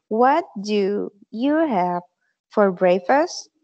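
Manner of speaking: "What do you have for breakfast" spelled out slowly, word by word; slow model sentence. Shown as a demonstration. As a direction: say it slowly for the class to repeat. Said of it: "se pronuncia, se dice". (pronunciación lenta y clara)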